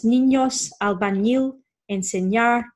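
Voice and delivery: niños, albañil, enseñar with an NY sound; Spanish niños, albañil, enseñar, N gliding into Y. Shown as a demonstration. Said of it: "It's a new sound".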